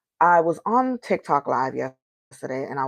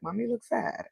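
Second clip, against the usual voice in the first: higher, softer hint of a child; lightly voiced son; 7 semitones higher, 6 dB quieter than usual. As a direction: lightly imitate a little boy addressing his mother. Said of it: "my son was like".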